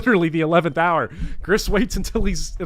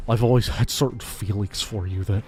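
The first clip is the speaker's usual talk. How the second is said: low, smug nasal pedant voice; neckbeard voice; 6 semitones lower than usual. Pretend. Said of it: "in the neckbeard bopper voice".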